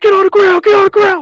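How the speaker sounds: high, loud barked order; imitated drill sergeant yelling. Drill sergeants swarming a new kid, mock yelling. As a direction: yell it right in a recruit's face. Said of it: "they start yelling in your face".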